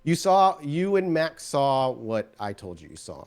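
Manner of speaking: normal talking voice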